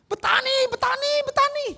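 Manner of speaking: very high, loud imitated scream; shrieking. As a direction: mimic a possessed shriek, repeated. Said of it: "Langsung dia teriak". (jeritan melengking)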